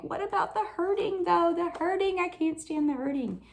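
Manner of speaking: high-pitched, plaintive protest; pained pleading. Retold or imitated. Believer pleads with God about the hurting, plaintive.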